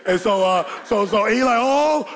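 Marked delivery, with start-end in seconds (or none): 1.6-2.1 high-pitched yelp of dread; mock alarm. Brother flying toward the window, mock alarm. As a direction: cry out high, imitating a panicked kid.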